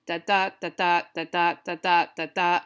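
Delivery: "da, da" in weak-strong pairs, every second one punched; iambic pentameter beat. Shown as a demonstration. Teacher showing what iambic pentameter sounds like, stressing every second "da". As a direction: tap out da-DA five times, evenly. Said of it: "that's iambic pentameter".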